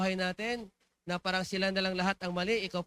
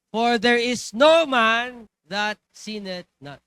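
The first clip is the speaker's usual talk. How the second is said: slow, loud, solemn proclamation of the verse; proclaimed scripture; 3 semitones higher, 11 dB louder, much slower than usual. Acted. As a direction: boom out the verse from the pulpit. Verse proclaimed loudly, slowly.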